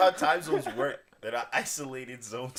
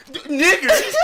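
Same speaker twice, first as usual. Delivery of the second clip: sudden high shouted punchline; meme shout; 13 dB louder, slower than usual. Retold or imitated. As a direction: blurt it out like the meme.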